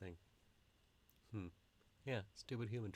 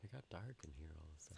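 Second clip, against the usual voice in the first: whispering; 4 semitones lower, 11 dB quieter, faster than usual. Real, not acted. Lights just went off.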